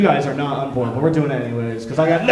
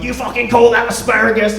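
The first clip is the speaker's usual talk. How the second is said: loud, high-pitched mock chef tirade; Gordon Ramsay; 6 semitones higher, 5 dB louder than usual. Celebrity chef scolding some old lady, angry yelling. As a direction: berate the cook in mock outrage. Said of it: "Gordon Ramsay who's like".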